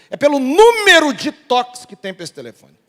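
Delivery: loud, high outburst on a retold call; exasperated operator. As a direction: imitate a caller blurting out the reason. Acts exasperated phone operator explaining.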